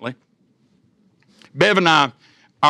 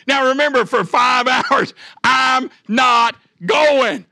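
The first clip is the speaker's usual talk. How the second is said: higher and louder, words broken by laughter, slow on I'm not going; laughing while talking; 8 semitones higher, 4 dB louder, slower than usual. Real, not acted. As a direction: push through the laugh to hit the irony. Laughing at his own story.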